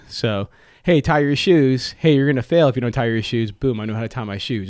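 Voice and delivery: normal talking voice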